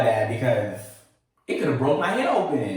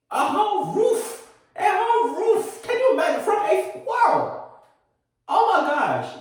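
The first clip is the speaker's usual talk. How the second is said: incredulous. Retold or imitated. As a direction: drag it out like you still can't believe it. Retelling near-disaster in disbelief.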